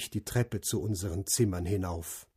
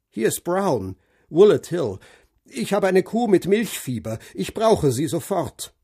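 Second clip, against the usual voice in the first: metallic, brisk phone voice, higher and louder; curt farmer barking down the line; ring modulator; 7 semitones higher, 5 dB louder than usual. A narrator's reading. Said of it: "Eine metallische Stimme, energisch und hellwach". (metallische, energische Stimme am Telefon)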